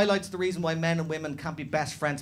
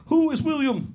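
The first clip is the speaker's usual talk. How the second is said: high, louder mock Eastern European cop; Eastern European accent; 11 semitones higher, 6 dB louder than usual. Fake cop singling out the stag, Eastern European accent. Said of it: "this is my Eastern European accent".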